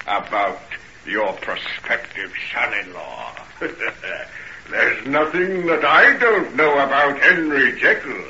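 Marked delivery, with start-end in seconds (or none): 3.4-4.6 breathy, gloating villain laugh; mocking little snicker; sinister chuckle. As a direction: chuckle softly with menace. Hyde mocks the father.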